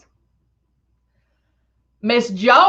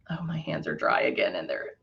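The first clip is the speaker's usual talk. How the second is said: whispering to herself; 12 dB quieter than usual. Real, not acted. Fumbling with lotion-slick hands.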